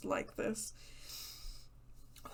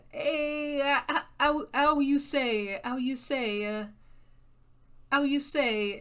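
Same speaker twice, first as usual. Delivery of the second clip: higher, louder hammed-up French accent; mock French accent; 5 semitones higher, 5 dB louder, slower than usual. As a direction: play a cartoon French guy groping for words. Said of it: "into every sentence for French".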